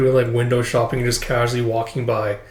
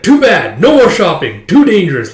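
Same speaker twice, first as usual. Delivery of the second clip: loud, raised mock protest; acted exasperation, higher and louder; emphatic refusal; 5 semitones higher, 7 dB louder than usual. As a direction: shut it down in punchy phrases. Brother cuts the shopping short.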